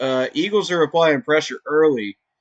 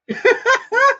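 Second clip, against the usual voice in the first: delighted laugh; 4 dB louder than usual. Real, not acted. Laugh after quote.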